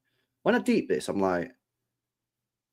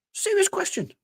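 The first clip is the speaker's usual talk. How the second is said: much higher emphatic. Real, not acted. Repeats rhetorical question.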